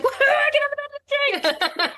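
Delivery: very high, whooping celebration; drunken woohoo. Retold or imitated. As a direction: cheer it like you're tipsy. Celebrating another drink.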